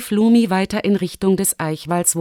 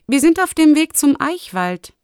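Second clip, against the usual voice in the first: put-on high child-character voice; young fox girl; 8 semitones higher, 4 dB louder than usual. Fox girl answers her friend. (hohe Stimme des Fuchsmädchens)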